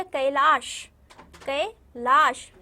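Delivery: कैलाश stretched slowly with a loud open "kai"; loud, slow ai demo. Shown as a demonstration. Teacher drilling कैलाश loudly for young students. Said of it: "क्या हो गया?".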